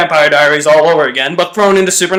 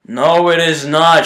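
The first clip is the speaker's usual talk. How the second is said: ordinary delivery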